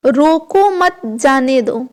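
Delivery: very slow, word by word, रोको drawn out; slow demonstration. Shown as a demonstration. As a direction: spell out रोको मत जाने दो at half speed. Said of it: "एक इसमें क्या कह रहे हैं".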